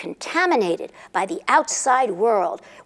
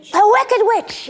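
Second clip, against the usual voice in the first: dramatic reveal; 9 semitones higher, 6 dB louder, slower than usual. A narrator's reading.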